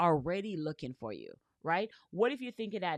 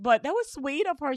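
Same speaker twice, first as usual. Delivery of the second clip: put-on girlfriend's sweet reply; 8 semitones higher, 6 dB louder than usual. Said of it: "She's like".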